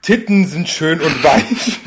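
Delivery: slow, loud solemn command; slow exorcist command. Pretend. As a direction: intone it like an exorcist.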